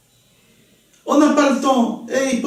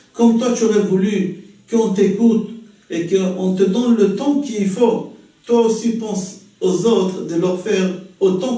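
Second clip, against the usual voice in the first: gentle sermon; 3 semitones lower than usual. Acted. Morale prêchée doucement.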